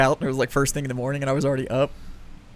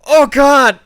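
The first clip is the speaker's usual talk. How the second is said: very loud, high mock wail; retold pained outcry; 11 semitones higher, 14 dB louder than usual. Imitating Caitlin with pepper in her eye, loud pained yell. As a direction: cry out as if the eye is burning. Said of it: "I see her going".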